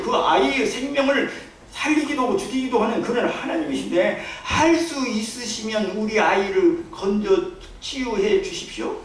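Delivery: usual speaking voice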